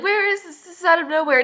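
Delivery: high, rising bewildered outburst; mock freak-out. Heroine freaking out at his warning, mock panic. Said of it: "She's freaking out".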